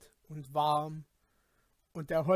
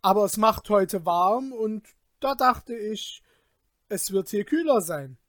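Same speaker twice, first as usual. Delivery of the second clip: higher, louder, flustered lying; nervous excuse; 5 semitones higher, 8 dB louder than usual. A narrator's reading. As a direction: talk fast to cover a lie. Peter lies nervously. (nervöse Ausrede)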